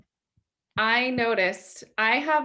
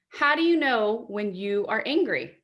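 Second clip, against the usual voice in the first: ordinary delivery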